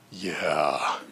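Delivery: put-on low, drawn-out little-kid "yeah"; lightly voiced kid agreeing, low and soft; cool kid's yeah. Granddaughter answers the neighbor that it's cool, lightly voiced low yeah.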